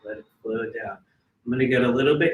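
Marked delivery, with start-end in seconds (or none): 0.0-1.0 freediver breathing. Acted. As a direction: count them down calmly, then breathe. Leads a breathing exercise.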